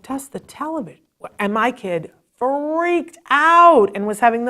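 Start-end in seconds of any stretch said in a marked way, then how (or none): 0.0-1.0 high, soft questioning repeat; baffled echo. Retold or imitated. Mother repeating the therapist's odd order, baffled. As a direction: echo it quietly in disbelief.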